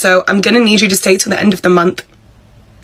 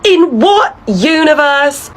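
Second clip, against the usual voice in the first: incredulous; 7 semitones higher, much slower than usual. Acted. Emily incredulous at boss's call.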